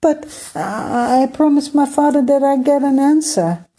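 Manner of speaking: high, pleading, near tears; pushing through the stammer, desperate; upset cry. Acted. Prince Emir pleading with Skymaker for his father's answer, high upset voice. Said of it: "Emir cried".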